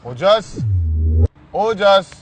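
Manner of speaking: calling out. Real, not acted. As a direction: call the name up toward the fourth floor. Calling friend down from flat.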